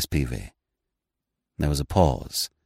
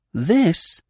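sharp; 11 semitones higher than usual. Acted. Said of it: "he broke off sharply".

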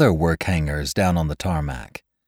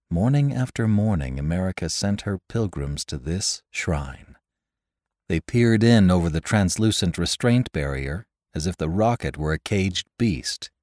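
plain reading voice

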